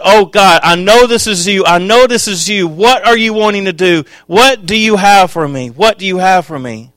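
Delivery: high, loud, urgent pleading; fervent cry to God. Retold or imitated.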